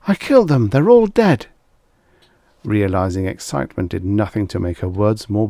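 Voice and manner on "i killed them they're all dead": high, loud overexcited outburst; a desperate woman bursting into the editor's office; frantic confession. A narrator's reading. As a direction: blurt it out breathlessly.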